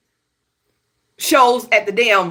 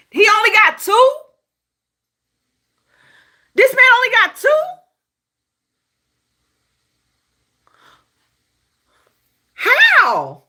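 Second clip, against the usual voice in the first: incredulous; 13 semitones higher, 5 dB louder than usual. Real, not acted. Disbelief at Grammy count.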